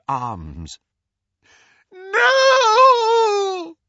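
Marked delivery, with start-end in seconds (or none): 1.9-3.8 very high, loud despairing cry; anguished scream. Acted. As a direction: scream out in horror. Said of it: "The scream was the more terrible".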